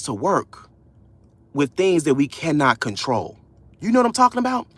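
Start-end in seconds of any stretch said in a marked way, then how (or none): none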